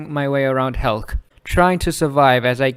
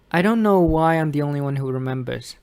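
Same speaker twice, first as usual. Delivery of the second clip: usual speaking voice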